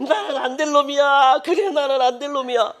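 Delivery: self-pitying sinner. Retold or imitated. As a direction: laugh bitterly at yourself as a hopeless sinner. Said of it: "요렇게 만드는 게 누굽니까". (자조하며 웃는 죄인 흉내)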